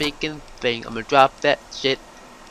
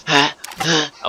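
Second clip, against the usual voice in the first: normal talking voice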